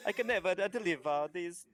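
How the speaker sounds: lightly voiced kobold with an Italian lilt, fretting; worried little trader dreading his papa's anger; mock Italian kobold, mildly upset; kobold's fretful accent. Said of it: "He's like".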